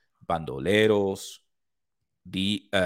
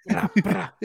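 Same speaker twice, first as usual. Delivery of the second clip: mock gunshot noises; 9 semitones higher than usual.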